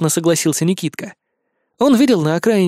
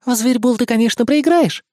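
higher, sly confiding fox voice; 8 semitones higher than usual. A narrator's reading. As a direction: tell it as a secret. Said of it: "доверительно поведала на ушко".

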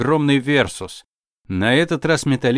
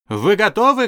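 hyped shout; 8 semitones higher than usual. Acted. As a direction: pump up the crowd. Announcer repeats, pushing crowd.

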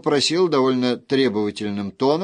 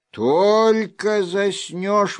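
higher, slow sleepy grumble; grumbling; 9 semitones higher, 4 dB louder, much slower than usual. A narrator's reading. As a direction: grumble it, woken too early. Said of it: "ворчал он".

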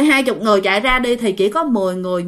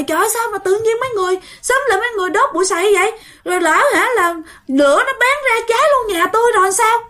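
high, fast scolding shout; angry yelling; 9 semitones higher, faster than usual. Retold or imitated. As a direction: scold the neighbours in a rush of alarm. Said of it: "sao dượng la quá trời". (chồng la mắng hàng xóm đốt bụi sậy, giọng cao và nhanh)

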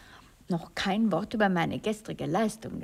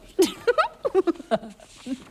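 high, loud teasing laugh; mocking laugh; 10 semitones higher, 6 dB louder than usual. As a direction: laugh at him playfully. Actress laughs at her lover.

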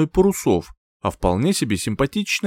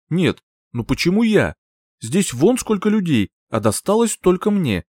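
higher-pitched aggrieved complaint; mildly angry indignation; 6 semitones higher than usual. A narrator's reading. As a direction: protest the unfairness, a touch angry. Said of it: "буквально тряслась от гнева".

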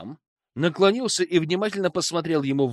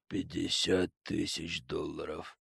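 emotionless; 11 semitones lower, 12 dB quieter than usual. Acted. Hypnotized Sam answers flatly.

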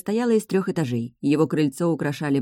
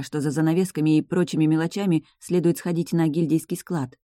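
plain reading voice

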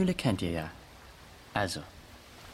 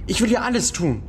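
louder, higher, pleading; desperate begging; 4 semitones higher, 4 dB louder than usual. Acted. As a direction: offer anything to the bully, frightened. Boy begs what he must do.

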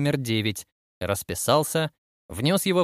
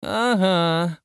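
drawn-out, higher-pitched mild croak; 6 semitones higher than usual. A narrator's reading. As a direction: croak it out, stretching the vowels. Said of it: "прокаркал Кэссиди".

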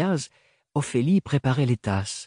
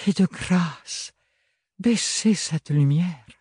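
slow, tired plea, pitched higher; weary voice; 5 semitones higher, slower than usual. Acted. Bérénilde, exhausted in bed, asks to dim the light, weary. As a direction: drag each word out wearily. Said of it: "d'une voix lasse".

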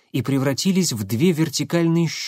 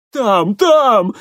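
испуганно; 9 semitones higher, 8 dB louder than usual. Acted. Водитель в панике показывает дорогу.

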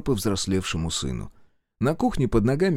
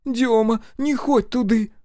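высокий умоляющий голос матери, нарастает к концу; заклинает сына не ходить, всё громче; 4 dB louder, slower than usual. A narrator's reading. Mother calls again. (высокая мольба матери, громче к концу)

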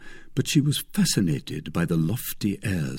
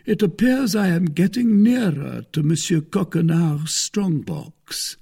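higher-pitched, self-satisfied musing; gloating Musketeer; 8 semitones higher than usual. A narrator's reading. Porthos thinks of the strong box, gloating. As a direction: savor the nearing prize.

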